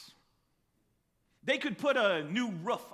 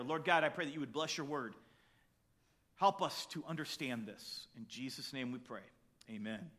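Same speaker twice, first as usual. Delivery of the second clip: soft, low prayer voice; hushed closing prayer; quiet prayer; 7 semitones lower, 6 dB quieter than usual. Acted. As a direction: pray gently over the word. Opening prayer before the teaching.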